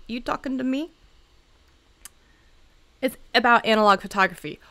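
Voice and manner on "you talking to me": mock Taxi Driver menace; lightly voiced tough guy, no real edge; half-joking gangster challenge; tough-guy movie quote. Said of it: "Oh, that was very, like".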